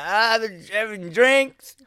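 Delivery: high, drawn-out mock drunk voice; acted tipsy wail, slow and high. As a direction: slur it like a hammed-up drunk. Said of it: "and he's drunk".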